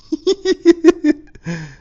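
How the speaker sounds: high, loud real laugh breaking out; burst of laughter. Real, not acted. Cracking up at his own nervous confession to [name], loud laugh. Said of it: "me cagué de la risa".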